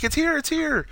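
high-pitched eager announcement; mock excitement. Biff bringing in the box, hammed-up excitement. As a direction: imitate an overexcited helper bursting in.

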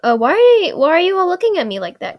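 high, loud puzzled question; hammed-up bewilderment, pitch jumping up; mock bewildered Aang. Aang to the staring villagers, mock bewildered. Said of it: "Aang goes".

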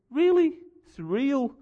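disbelieving jump up high; mock outrage at the word; Really? Surreal? squeaked high in disbelief; incredulous. Incredulous retort to fan.

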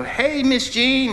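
acted-out singsong hello; calling out a greeting. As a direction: stretch it out like hailing someone across a room. Acted greeting across the church.

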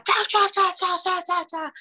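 high-pitched barrage of mock foreign yelling; imitated shouting. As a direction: rattle off nonsense syllables like an angry shouter. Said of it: "a gritar".

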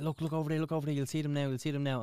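put-on retelling someone pointing out red eyes to kids, urgently. Acted-out excited urging.

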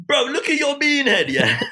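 high, cheeky London roast; cussing in roadman slang; south London accent. Retold or imitated. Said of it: "I was like".

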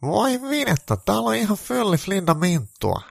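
hyvin korkea, innostunut kiljahdus; innoissaan. A narrator's reading. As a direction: kiljaise riemusta kuin Andy. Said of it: "Andy sanoi innoissaan". (innostunut korkea Andyn ääni)

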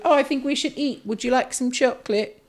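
put-on high, sweet little girl voice; mocking the girl offering chocolate. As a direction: put on a prissy child's voice. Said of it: "she's like".

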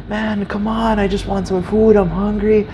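high, whiny imitated plea; pleading beggar.

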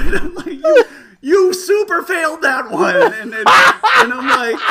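high, loud talk broken by laughter; can't stop laughing while telling it; laughing hard. Real, not acted.

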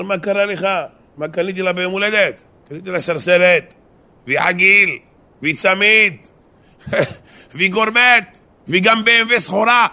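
high, loud, escalating list of presents; boastful gift list. Acted. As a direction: build each item bigger, as a proud husband showing off. Husband boasts of his many gifts. (מתרברב בקול רם וגבוה)

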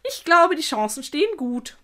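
bright-spirited spider butting in; cheerful interjection. A narrator's reading. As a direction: chime in cheerfully. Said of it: "warf der Beißer fröhlich ein".

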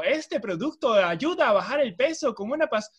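slow, loud, singsong TV sales voice; TV infomercial pitch. Acted. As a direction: sell it like a late-night infomercial. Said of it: "esos productos que venden por televisión".

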